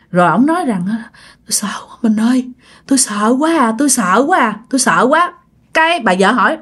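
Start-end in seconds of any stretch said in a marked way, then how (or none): 1.4-2.5 fast frightened whisper of "Tôi sợ quá mình ơi"; terrified whisper. Acted. Vẻ mặt của ổng rất là sợ hãi. 2.9-5.3 voiced, a touch scared "tôi sợ quá"; mild frightened voice. Vẻ mặt của ổng rất là sợ hãi.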